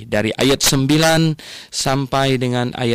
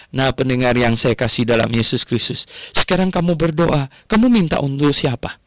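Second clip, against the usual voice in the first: ordinary delivery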